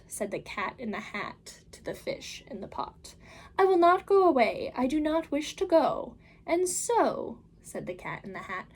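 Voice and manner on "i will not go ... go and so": bright, higher, louder showman cat; the Cat in the Hat calming the children, big and upbeat. Acted. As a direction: reassure the kids with a grand flourish. Cat refuses to leave.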